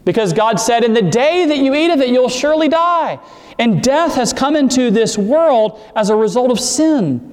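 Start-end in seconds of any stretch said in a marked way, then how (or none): 0.8-3.2 solemn warning. Retold or imitated. Quoting God's solemn warning.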